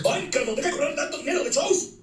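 high whiny mock of the guy, lifted well above normal; mocking whine. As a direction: sneer it in a high nagging voice. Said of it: "ese mismo güey que me dice". (burla aguda y quejumbrosa)

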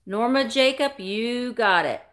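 usual speaking voice

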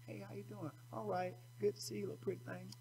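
soft passing greeting. Retold or imitated. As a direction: toss off a sweet hello.

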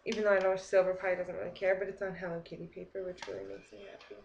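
ordinary talk, nothing marked

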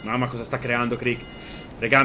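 ordinary talk

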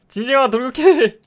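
high, loud battle cry; rally him to charge; cheering charge. Real, not acted. Player urging Squidward mob to attack, loud excited cry.

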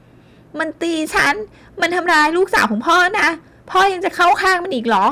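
high, loud wailing complaint; whining furious accusation. A narrator's reading. Drunk daughter accusing her husband to her father, shrill fury. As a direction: cry foul at your father.

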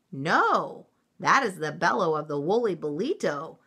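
usual speaking voice

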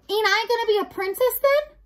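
put-on high, loud, hurt serving girl; dismayed question. Mig sees her dream denied by the rat, high dismayed voice.

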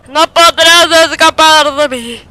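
mock villain threat to fleeing enemy; villain taunt. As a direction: ham it up like a movie villain. No podrás escapar de mí.

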